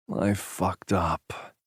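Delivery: slow, very soft confession; ashamed hungover confession; quiet admission. A narrator's reading. Admits, head in hands.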